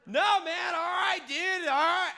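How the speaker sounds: loud, high-pitched yell; real exasperated shout. Real, not acted. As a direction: shout over your friends to cut them off. Shouting down the others.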